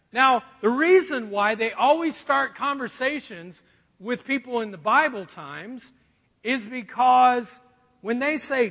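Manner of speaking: ordinary delivery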